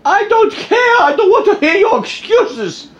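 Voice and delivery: high, loud outraged shouting; mock angry customer. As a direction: yell for the supervisor, hammed-up. Mock irate customer demands supervisor.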